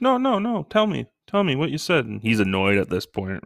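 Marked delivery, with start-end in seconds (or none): 0.0-2.0 high, mock-insistent needling; teasing. Dad pestering his son to repeat his curse, high teasing voice. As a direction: pester him playfully.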